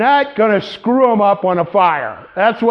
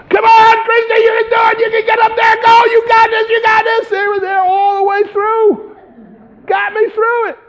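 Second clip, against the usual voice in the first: high, loud cheering shout; 4 dB louder than usual. Retold or imitated. Firefighters shouting encouragement up the ladder, cheering.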